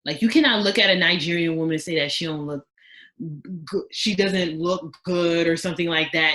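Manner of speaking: ordinary talk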